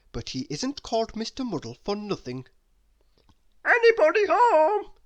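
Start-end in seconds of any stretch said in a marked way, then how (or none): 3.7-4.9 very high, loud call into the house. A narrator's reading. Mister Muddle calling into the empty cottage, high and loud. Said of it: "he called".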